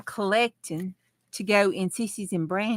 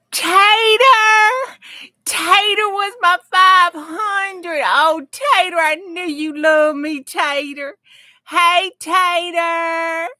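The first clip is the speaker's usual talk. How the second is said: high, loud, thrilled calling of the name; overjoyed; 10 semitones higher, 8 dB louder, slower than usual. Real, not acted. Thrilled host calling out to her viewer, high and loud. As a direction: burst with joy at the name. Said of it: "I got so nervous and excited".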